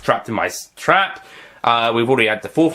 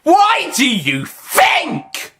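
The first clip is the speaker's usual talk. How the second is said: sneering villain; 5 dB louder, much slower than usual. Acted.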